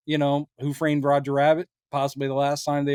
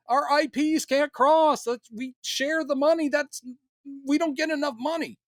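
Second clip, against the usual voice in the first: mock corporate exec; 11 semitones higher than usual. Mocking the parent companies to his cohost, high whiny exec voice.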